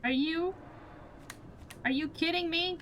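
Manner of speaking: high-pitched rising disbelief; incredulous. Real, not acted. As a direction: ask it in high, stunned disbelief. Disbelief at game event.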